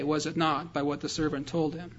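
ordinary talk

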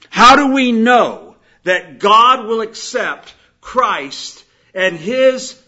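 loud, slow, emphatic sermon voice; preaching. Acted. Preacher proclaiming Christ as substitute, slow forceful preaching. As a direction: hammer out each phrase to the congregation.

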